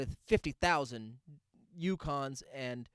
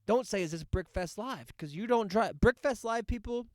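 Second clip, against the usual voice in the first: rising rant; 7 semitones higher, 5 dB louder than usual. Real, not acted. Ranting about the parking moms.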